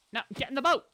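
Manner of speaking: loud, high-pitched order; insistent command. Real, not acted. Player ordering a sheep into a boat, loud and insistent.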